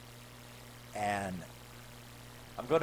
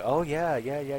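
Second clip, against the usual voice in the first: mock moan; 6 semitones higher than usual. Voicing woman getting into sex.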